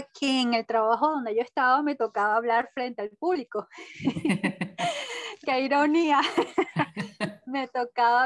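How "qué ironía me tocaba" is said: amused, laughter either side; laughing. Real, not acted. Speaker laughing at the irony of public speaking, laughing. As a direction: say it through a chuckle.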